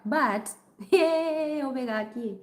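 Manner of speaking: ordinary delivery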